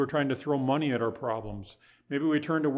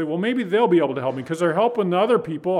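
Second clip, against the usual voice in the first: mock excuse; 4 semitones higher, 7 dB louder, faster than usual. Voicing Ahaz's excuse.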